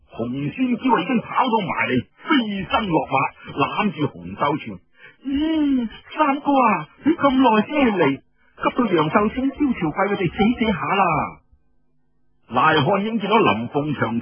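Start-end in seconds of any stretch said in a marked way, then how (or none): 5.3-11.0 put-on high, coy girlish voice; coy young sister. Hong Xuanjiao scolds her late brother, coy and high. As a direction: whine sweetly at her long-awaited big brother. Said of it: "喺度詐嬌".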